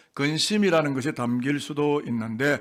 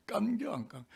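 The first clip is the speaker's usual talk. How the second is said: Chungcheong dialect; 7 semitones higher, 9 dB quieter than usual. Shown as a demonstration. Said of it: "확실히 충청도 분 여기 계시고".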